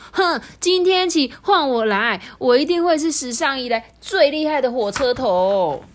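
can't wait to prove himself; impatient boast. Acted. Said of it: "就等不及说".

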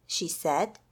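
usual speaking voice

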